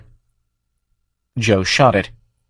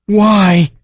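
high, louder plaintive creature cry; 11 semitones higher, 5 dB louder than usual. Acted. The dying farm asking Joe why, high plaintive creature. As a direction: ask it like a wounded thing. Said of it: "it asked plaintively".

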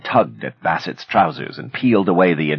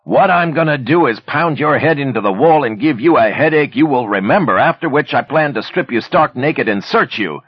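loud, higher threatening yell right into the ear; yelled threat; 9 semitones higher, 4 dB louder than usual. A narrator's reading. Manny pins Bassett and yells a threat into his ear. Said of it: "Manny yelled into his ear".

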